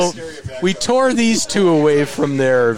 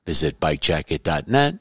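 steady, even podcast ad read, a bit lower; sponsor ad read; 5 semitones lower than usual. Acted. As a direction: read the sponsor spot like a host ad. Host reads sponsor ad.

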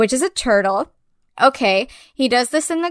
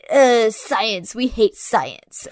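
dumb mocking voice; 2 semitones lower, much slower than usual. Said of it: "so they could be like".

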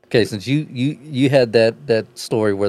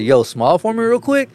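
higher-pitched retold call-out; imitating friend's request; 10 semitones higher than usual. Friend's request acted out in story.

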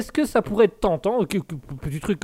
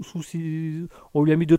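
ordinary talk, nothing marked

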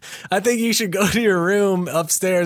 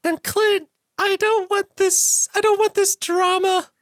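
very high, slow falsetto plea; pretend Isabella. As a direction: plead like a damsel in falsetto. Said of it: "pretend to be Isabella".

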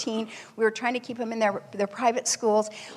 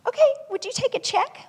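nervous plea; 13 semitones higher than usual. Retold or imitated. Mom pleading with waiter after declines.

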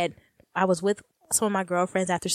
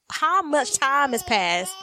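slow, higher, stretched-out question; stoned, drawn-out puzzlement; dazed wondering; 6 semitones higher, slower than usual. Retold or imitated. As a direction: draw out each word as if lost in time. Acting out losing track of time.

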